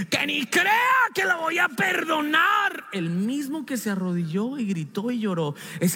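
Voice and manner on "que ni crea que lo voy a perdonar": resentful outburst. Retold or imitated. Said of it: "pero hablamos así".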